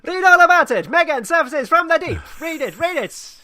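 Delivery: loud, high paperboy shout; 1920s newsreader. Retold or imitated. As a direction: hawk the headlines like an old newsreel. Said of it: "felt compelled to talk like a nineteen twenties, uh, newsreader or gangster".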